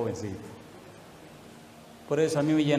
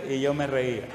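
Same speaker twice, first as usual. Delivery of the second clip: ordinary talk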